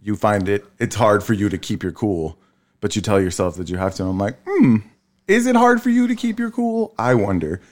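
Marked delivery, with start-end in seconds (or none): 4.5-7.6 very high, feigned-innocent question; sarcastic musing, pitched way up; mock wondering. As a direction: wonder aloud in a put-on high voice. Acts own sarcastic reply to wife.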